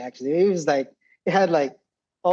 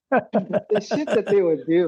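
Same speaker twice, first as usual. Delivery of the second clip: slow, higher, words breaking into laughter; real laughing; 5 semitones higher, slower than usual. Real, not acted. Veteran remembers his friends' pranks, laughing as he talks. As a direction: laugh through the words as you reminisce.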